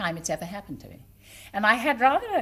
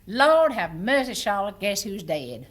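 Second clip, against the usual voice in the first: put-on Virginia old lady; 5 dB louder than usual. Old lady to Charlotte over newspaper, Virginia accent. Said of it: "I said the first line".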